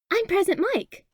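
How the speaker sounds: put-on high excited child voice; a small boy proudly claiming his hero; squeaky high kid announcing; excited little kid. A narrator's reading.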